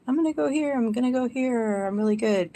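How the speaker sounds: breezily fobbing off friends with a lie; chirpy excuse. Retold or imitated. To friends offering a place to stay, high chirpy excuse.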